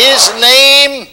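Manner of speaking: loud, high, very slow call for the crowd to repeat; preaching call. Acted. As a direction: chant it out line by line. Call and response.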